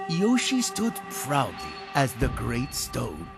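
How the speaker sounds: ordinary delivery, nothing marked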